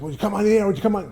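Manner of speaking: high, eager mock plea to come on air; eager producer.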